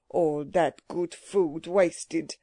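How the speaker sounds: put-on slow, mournful old man in a foreign accent; accented old businessman. Old Levinstein laments wasted food to his clerk, slow accented lament. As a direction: draw it out with thick accented dismay.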